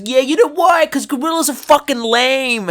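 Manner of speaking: loud, high Boston bro retort; Boston accent. Retold or imitated. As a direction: fire it off like a proud Boston guy. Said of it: "traditional Boston accent".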